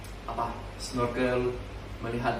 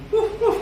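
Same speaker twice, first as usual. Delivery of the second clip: imitated noise; 9 dB louder than usual. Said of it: "seperti ini".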